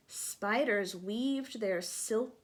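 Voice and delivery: ordinary delivery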